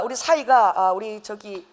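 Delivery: ordinary talk, nothing marked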